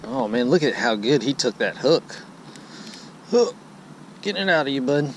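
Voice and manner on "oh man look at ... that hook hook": ordinary delivery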